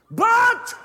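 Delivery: very high, very loud single burst; shouted word. Real, not acted. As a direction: belt it out for the congregation to echo. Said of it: "Everybody shout the next word".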